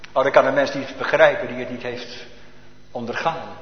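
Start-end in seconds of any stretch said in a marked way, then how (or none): none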